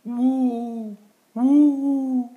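high, loud rising woo of the wind; howling wind. Acted. Dad reading to his kids, making the wind howl. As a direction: howl like a spooky night wind.

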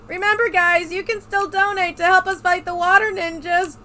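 high, loud stream plug; cheery loud promo voice; loud mock announcement. Real, not acted. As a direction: shout the reminder to the viewers.

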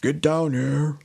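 mock-menacing husband; Bluebeard. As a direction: order someone downstairs, half-playing. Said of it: "Bluebeard was like".

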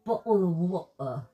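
slow, loud mock British accent. Teasing the British guest, slow mock British accent. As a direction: draw out an imitated British accent. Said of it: "Now he's got a British accent".

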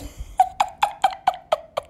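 high, breath-driven cackle; helpless high giggling; laugh. Real, not acted. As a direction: burst out laughing. Laughs at own joke.

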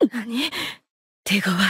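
low, soft reaction under pressure; hushed, low battle aside; subdued dismay. Acted. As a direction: take the setback quietly, voice dropped. Setback in battle.

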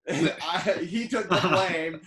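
real laughter while telling it; laughing. Real, not acted. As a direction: chuckle out the punchline. Laughing while telling embarrassing story.

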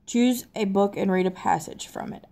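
usual speaking voice

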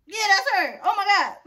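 loud, high, shocked recognition. Retold or imitated. Shock at finding stalker.

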